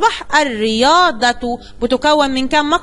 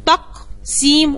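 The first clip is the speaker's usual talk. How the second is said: slow syllables; much slower than usual. Shown as a demonstration. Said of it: "القاف عليها سكون".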